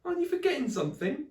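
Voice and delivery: high fast pointed question; indignant call. Acted. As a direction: remind him sharply. Polly calls after departing Friday.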